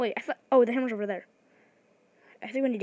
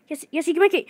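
ordinary talk, nothing marked

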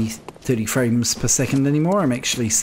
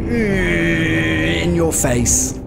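taunting; 4 semitones higher, much slower than usual. Real, not acted. Taunting a defeated enemy.

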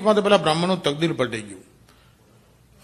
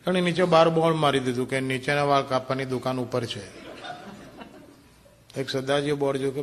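ordinary delivery

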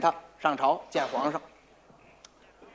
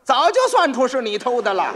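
loud, higher smug boast; smug claim; 6 semitones higher, 6 dB louder than usual. Acted. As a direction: brag that you knew all along. 老黄假装早已算出. (得意洋洋地大声说)

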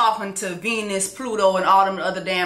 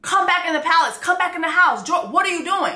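high, pleading calls after him; pleading wife; 8 semitones higher than usual. Retold or imitated. Wife begging her husband to come back in, high and pleading. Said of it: "And she's like".

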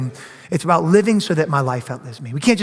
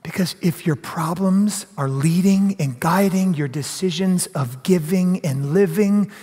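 usual speaking voice

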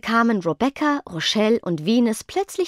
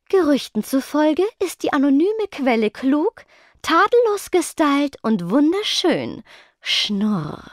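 higher, smug announcement ending in a purr; triumphant; 7 semitones higher than usual. A narrator's reading. Tora Lee hints that she is the source, triumphant. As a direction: proclaim it with catty self-satisfaction. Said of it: "verkündete Tora Lee und zuckte triumphierend".

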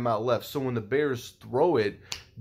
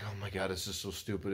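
exasperated mutter; 3 semitones lower, 11 dB quieter than usual. Retold or imitated. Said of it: "I was just like".

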